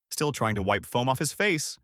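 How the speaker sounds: plain reading voice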